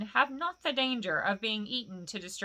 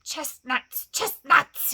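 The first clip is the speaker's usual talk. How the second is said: spat out, the second much louder; hissed; 5 semitones higher, 8 dB louder, much slower than usual. Acted. Gollum scornfully answers Bilbo, hissed. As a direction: hiss it with scorn. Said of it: "he hissed".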